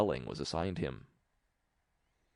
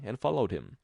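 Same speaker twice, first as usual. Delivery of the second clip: usual speaking voice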